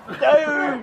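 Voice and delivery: sudden high outcry; shocked outcry. Real, not acted. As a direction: blurt it out in shock. Reacting to a gross bean.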